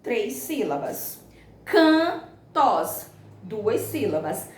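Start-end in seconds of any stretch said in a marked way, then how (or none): 1.6-3.1 "Cantos" split into can, tos; two separate syllables in "Cantos". Shown as a demonstration. Teacher separating syllables for the class, "Cantos" in two pieces. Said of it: "a separação silábica delas".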